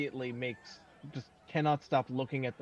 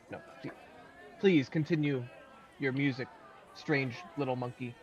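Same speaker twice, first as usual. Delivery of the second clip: usual speaking voice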